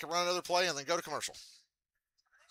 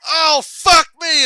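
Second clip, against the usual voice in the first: drawn-out furious yell, much higher and louder; angry yell; 13 semitones higher, 18 dB louder, much slower than usual. Retold or imitated. Tourette's Guy swearing at a broken pen, yelling. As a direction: bellow the curse out slowly. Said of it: "and he goes".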